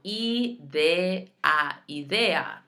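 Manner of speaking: idea as ee-DEH-ah, syllable by syllable; Spanish idea with pure EE, EH, AH; every vowel sounded. Shown as a demonstration. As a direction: separate the vowels of idea, then join them. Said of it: "you're saying every vowel".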